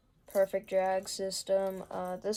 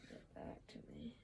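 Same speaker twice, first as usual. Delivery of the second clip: very soft, whispered aside; muttering to himself; 24 dB quieter than usual. Real, not acted. Angler busy with his gear, whispered muttering to himself. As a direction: say it under your breath, almost inaudibly.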